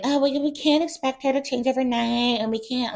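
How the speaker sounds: high put-on voice defending her friend; mocking Gina. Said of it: "Gina's like".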